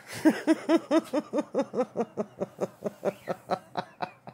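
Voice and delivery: long burst of real laughter; helpless real laugh. Real, not acted. Laughs at the dog.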